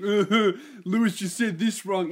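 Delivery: put-on voice of a pedantic poster; mocking nitpicker. Listeners posting corrections to the host in the group, mocking nitpicker. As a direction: mimic an annoying correction.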